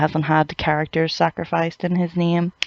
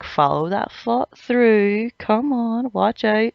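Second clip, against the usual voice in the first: mock coaching captain; 5 semitones higher, slower than usual. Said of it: "It was like".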